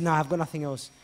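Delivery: loud, firm denial; stubborn refusal. Retold or imitated. Blind man insisting he has nothing, loud. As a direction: dig your heels in.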